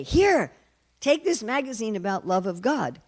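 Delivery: loud, high call to strangers; mock over-eager pitch, pushed out loudly; imitated hawking. Devotee hawking magazines to airport travellers, loud and eager.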